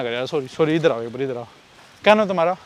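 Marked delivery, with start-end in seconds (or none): 0.0-1.5 out of breath. Real, not acted. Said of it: "सांस चढ़ गया मेरे".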